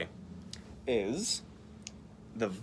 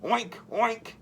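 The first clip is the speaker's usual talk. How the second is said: higher mock grunt; grunting pig; 8 semitones higher than usual. Voicing the pig in the picture book, grunting oink. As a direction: oink like a piggy. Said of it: "grunted the pig".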